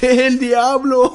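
high, slow, loud imitation; hammed-up shriek, drawn right out; classmate's outburst. Imitating classmate covering his mouth, shrieked outburst. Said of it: "he covers his mouth".

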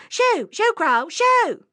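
high, loud shooing shout; scaring off crows. Acted. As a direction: yell to send the birds flying. Rabbit demonstrates scaring crows.

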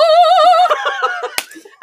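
high singing laugh; sung laugh. Real, not acted.

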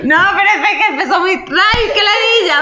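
high laughing complaint. Real, not acted. She laughs, protesting the other woman started too serious. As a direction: laugh while you protest.

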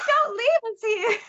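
high, drawn-out mock plea; pleading babies. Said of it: "And they're like".

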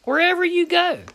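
"Wherever you go" sung out high and sustained; ringing echo. Real, not acted. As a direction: lift the phrase up like a shout of praise. Preacher echoes the verse high.

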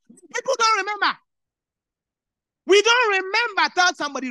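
loud, high, forceful exhortation; preaching shout. Real, not acted. Preacher rousing the congregation to remember, full-force shout. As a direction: hammer the point home to the crowd.

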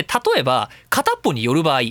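usual speaking voice

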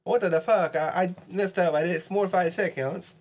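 high, sing-song mock Italian accent. Player protesting the rule to a friend in a mock Italian accent. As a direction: put on a hammy Italian accent, pitched well up. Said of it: "speak with an Italian accent".

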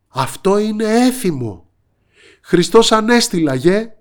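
high, loud joyful exclamation; excited. A narrator's reading. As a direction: burst out with Easter joy. Gull's joyful Easter exclamation.

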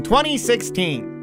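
normal talking voice

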